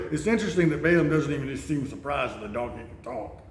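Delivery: ordinary talk, nothing marked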